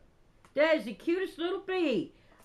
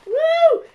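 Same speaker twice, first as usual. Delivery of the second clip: loud, high "woo" of delight; real overjoyed holler; excited whoop; 14 dB louder than usual. Real, not acted. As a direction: let out a sudden piercing whoop. Overwhelmed by gifts in a parcel.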